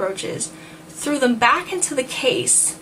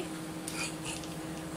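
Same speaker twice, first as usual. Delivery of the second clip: very soft breathy laugh under the breath; real, stifled laughter; laughing quietly at the mess; whispered laugh; 15 dB quieter than usual. Real, not acted. Outtake giggling fit.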